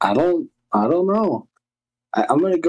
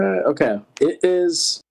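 ordinary talk, nothing marked

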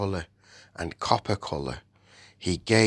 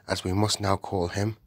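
ordinary delivery, nothing marked

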